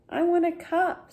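put-on high, childish ask; little boy voice. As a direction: lift it way up like a small boy wanting a cup. Small Benny asks for cup.

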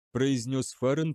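usual speaking voice

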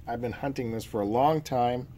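normal talking voice

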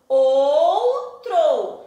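"Outro" loud and stretched, O gliding into U; drawn-out diphthong. Shown as a demonstration. Teacher demonstrates vowel sounds to pupils, "Outro" drawn out loudly. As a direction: stretch the first syllable of "Outro". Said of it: "eu usei o sonzinho do O".

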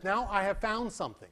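normal talking voice

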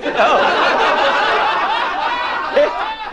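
high airy wheezing laugh; helpless voiceless cackle; breathless laugh. Real, not acted. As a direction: laugh silently, all breath.

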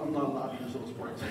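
usual speaking voice

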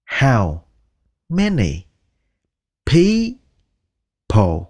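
"How", "many", "people" said one word at a time. Shown as a demonstration. Teacher breaking the phrase into words for learners. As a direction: split the question into separate words. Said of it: "word by word drill".

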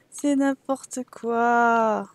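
slow amused laugh through the words. Real, not acted. Streamer laughing at her Sims, slow and amused. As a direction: laugh it off, drawn out.

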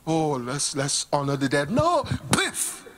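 ordinary delivery

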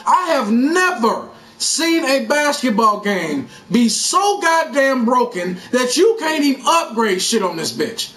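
normal talking voice

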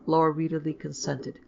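ordinary delivery

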